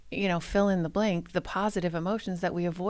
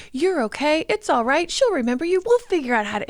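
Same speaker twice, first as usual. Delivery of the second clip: high, loud, frantic cheering-up; perky cartoon reassurance, pitched way up; Joy; 9 semitones higher, 5 dB louder than usual. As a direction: hurry to cheer up a crying friend. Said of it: "And Joy is like".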